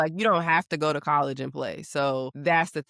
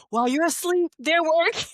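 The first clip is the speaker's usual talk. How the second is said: much higher, laughing mock-dad voice; high, comic parental motivation speech; imitating dad; 13 semitones higher, 4 dB louder than usual. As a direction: crack up while quoting dad's motto. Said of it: "he used to be like".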